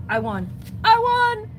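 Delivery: ordinary talk, nothing marked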